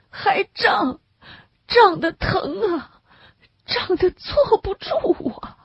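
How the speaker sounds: high, slow sobbing moans of pain; crying in agony. A narrator's reading. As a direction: weep and groan through the pain. (痛得哭喊，声音高而慢，带着哭声)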